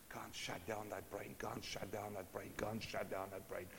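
whispered, restless and pleading; a soft fretful whisper to himself; anxious whisper. Acted. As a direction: breathe it out like a sleepless mind. Sleepless David's anxious inner voice.

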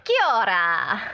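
drawn-out mock New Zealand greeting; stretched-out Kiwi hello; put-on Kiwi accent. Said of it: "all accents aside".

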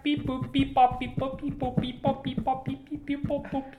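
high beeping robot noises; toy robot voice. Pretend. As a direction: beep and boop like a machine. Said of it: "stop making beep, boop noises".

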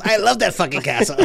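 high, loud, laughing child mimicry; mock six-year-old. Teasing the co-host over his castle outburst, mock six-year-old. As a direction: mimic an over-excited little kid, laughing. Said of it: "Never have you sounded more like a six-year-old".

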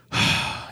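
long breathy exhale; heavy sigh. Real, not acted. Co-host baffled by the booking, long sigh. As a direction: let out a long sigh before answering.

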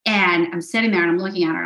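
ordinary talk, nothing marked

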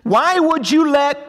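high, loud, slow angry cry; mad at God. Retold or imitated. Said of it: "we say it really mad".

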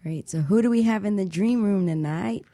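normal talking voice

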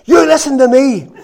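loud, high stern telling-off to a barking dog; preacher shouting down at his dog to stop. Retold or imitated. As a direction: scold the dog hard, every word firm. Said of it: "and I looked down, and I said".

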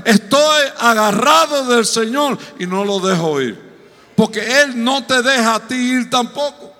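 loud, high-pitched sermon climax building in force; impassioned preaching. Real, not acted. Preacher urging the church to hold on to God, impassioned. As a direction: preach it at full force, driving each phrase harder.

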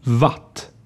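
Watt with a German-style W, said "vat"; isolated "Watt", v at the start; Swedish pronunciation model. Shown as a demonstration. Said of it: "zwei Wörter mit W nachsprechen".

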